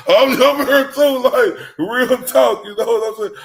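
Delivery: high, loud hyped outburst; excited. Real, not acted. To the host about the O G, high loud excitement. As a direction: shout it up, fired up.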